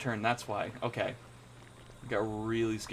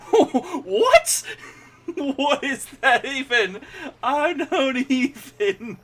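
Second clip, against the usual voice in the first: high, squeaky laughter through the words; laughing in disbelief; 14 semitones higher, 7 dB louder, much slower than usual. Real, not acted. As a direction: laugh helplessly at something absurd.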